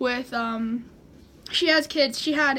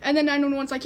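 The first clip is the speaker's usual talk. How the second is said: ordinary talk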